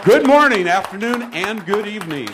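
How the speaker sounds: high, bright-edged on-air greeting, starting loud; radio host voice. Real, not acted. Host greets the audience on stage, radio host voice. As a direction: open the radio show, pitched well up. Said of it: "You sound like you do on the radio".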